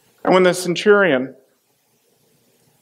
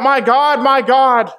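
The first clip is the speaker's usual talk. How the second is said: higher, louder cry of anguish; 6 semitones higher, slower than usual. Acted. Preacher voicing Jesus's cry from the cross, higher and louder. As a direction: lift the voice high, pleading. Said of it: "cried out in a loud voice".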